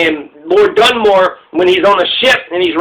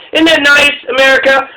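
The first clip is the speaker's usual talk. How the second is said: sarcastic; 6 semitones higher than usual. Real, not acted. Sarcastic jab at America.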